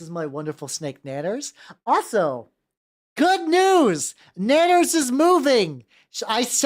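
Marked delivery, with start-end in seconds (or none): 3.2-5.8 high, slow, happy announcing; excited announcement. Real, not acted. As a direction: proclaim the good news with glee. Announcing snake news.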